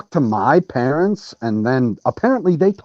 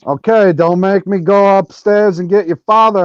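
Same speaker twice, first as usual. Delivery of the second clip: imitated mom warning, pitched up; raised, higher scolding parent voice; threatening parent; 6 semitones higher, 4 dB louder than usual. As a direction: lay down an exasperated parental threat.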